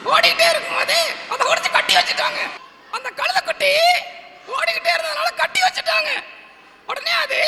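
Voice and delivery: high-pitched puppet storyteller; டிங்கன். Pretend. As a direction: tell a tale in a tiny squeaky character voice. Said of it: "ஒரு கதை சொல்லணும்னு". (கதை சொல்லும் கீச்சுப் பொம்மைக் குரல்)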